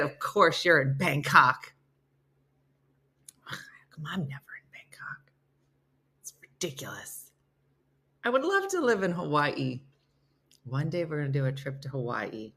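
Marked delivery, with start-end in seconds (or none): 3.4-7.3 breathy whispered complaint, much softer; whispered aside. Real, not acted. Envying a viewer in Bangkok, whispered aside. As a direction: grumble it under your breath.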